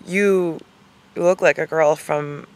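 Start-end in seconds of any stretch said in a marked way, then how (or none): none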